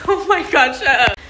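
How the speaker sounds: loud embarrassed laugh, shut up; laughing at herself. Real, not acted. Laughing at her own tears to her viewers.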